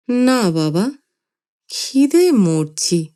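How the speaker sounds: put-on slow, drawn-out old man's whine; whining grandfather. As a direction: feeble grandfather moaning of hunger. Grandfather whines he's starving.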